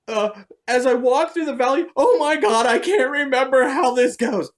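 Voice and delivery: high, loud mock-frightened recital; frantic high-pitched plea; panicked praying. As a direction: imitate someone fumbling a prayer in panic. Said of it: "like, freaking out".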